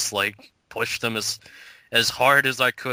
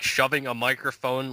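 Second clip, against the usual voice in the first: ordinary delivery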